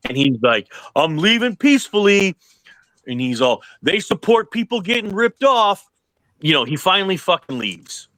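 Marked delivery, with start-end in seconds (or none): 1.0-2.3 higher-pitched mock yell; half-volume retold holler, voice lifted high; imitated yelling. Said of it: "the fucking show yelling this". 3.8-5.9 higher-pitched mock yell; half-volume retold holler, voice lifted high; imitated yelling. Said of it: "the fucking show yelling this".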